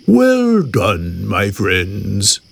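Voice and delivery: low cow voice, falling away; cow character. A narrator's reading. Cow praises the animals' trick, low cow voice.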